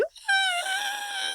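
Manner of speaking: very high screeching laugh imitation; high mock screech. Host imitating Bert screeching on set, very high shriek. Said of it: "Fucking starts screeching".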